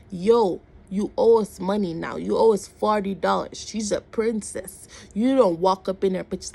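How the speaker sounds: loud, emphatic mock telling-off; imitating a man loudly demanding payment; retold loud scolding. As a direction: call out a passer-by like you're owed.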